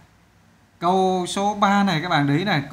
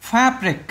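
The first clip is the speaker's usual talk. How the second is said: "Fabric" higher, stress on FAB; first-syllable stress; 6 semitones higher, 4 dB louder than usual. Shown as a demonstration. Teacher showing the stressed syllable, first-syllable stress. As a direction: hit FAB hard in "Fabric". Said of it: "âm đầu".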